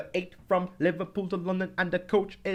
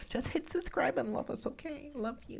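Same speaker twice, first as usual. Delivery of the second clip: much higher, soft cooing plea; sweet coo; 11 semitones higher, 10 dB quieter than usual. Acted. Co-host coos softly at listeners to subscribe.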